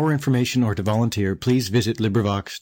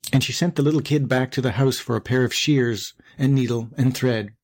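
ordinary delivery, nothing marked

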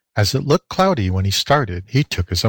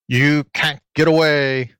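slow, raised mild fox howl; 5 semitones higher than usual. A narrator's reading. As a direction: stretch it out like a fox cornering prey. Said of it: "he howled".

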